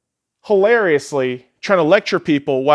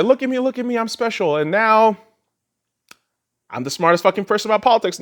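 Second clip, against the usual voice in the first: mocking; 7 semitones higher than usual. Mocking show-off liberal celebrities.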